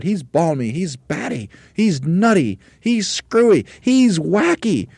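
building to very high, loud squeals; crying out. A narrator's reading. Monkeys calling their leader mad, crying out. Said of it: "they cried".

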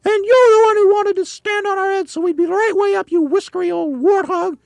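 very high, loud, furious screech; screamed. A narrator's reading. Mrs Twit insults her husband while stuck, screaming. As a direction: scream the blame back at him. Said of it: "screamed Missus Twit".

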